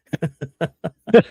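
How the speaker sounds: a burst of laughter at an absurd ending; amused laugh. Real, not acted. Co-host amused by the Butch Cassidy tale, laughing. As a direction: laugh out loud, high and bubbling.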